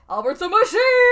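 high, loud, laughing shout of the line; over-the-top shout. Retold or imitated. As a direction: ham it up, yelling it at the crowd. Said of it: "but in that voice".

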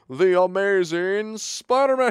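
mock fight-night introduction; ring announcer. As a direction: stretch it out for the arena. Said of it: "so the announcer goes".

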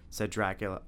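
ordinary delivery

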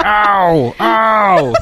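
high, loud mock ow; mock pain. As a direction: yelp it like a hurt kid. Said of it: "I'm like".

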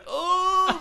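loud, high mock wail; mock crying. Kid thrown around by Sting, mock crying. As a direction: wail like a beaten kid, hammed up. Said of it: "like, crying".

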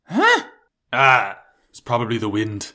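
gravelly bandit, startled then dismissive; Skyrim bandit voice. Retold or imitated. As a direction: do a lazy Skyrim guard bandit. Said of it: "In, like, the Skyrim bandit voice".

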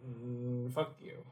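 ordinary delivery

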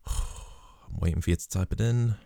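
soft, sighing, waiting on the listener; mock-patient hush. Real, not acted. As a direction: sigh it out as if tapping your foot. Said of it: "I will wait for you".

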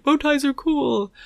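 lightly voiced Doctor quote; a hint of Matt Smith's Doctor; Matt Smith impression.

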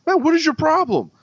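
high-pitched irritated demand; retold annoyed challenge. Truck owner turning round on the driver, irritated. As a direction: imitate an aggrieved stranger.

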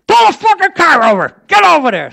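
loud high furious yell; cop yelling. Retold or imitated. Cop chasing the limo yells at the driver, loud high shout. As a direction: bellow like an angry cop.

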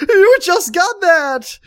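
high slow fake sobbing; mock crying. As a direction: whine it like a fake cry. Mock crying over console.

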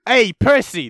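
high, loud put-on hail; hammed-up, high-pitched shout to a sidekick; Cool's character voice. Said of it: "This is how Cool talks".

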